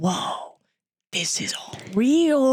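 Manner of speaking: whispery, drawn-out amazement, rising in pitch; awed conviction. Retold or imitated. Imagining seeing Krishna beyond doubt, awed conviction. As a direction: stretch it out slowly in a breath of wonder. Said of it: "feel with such conviction".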